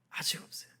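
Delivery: hushed whisper for emphasis; whispered repetition. Real, not acted. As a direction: drop to a breathless aside. Preacher drops to emphasis.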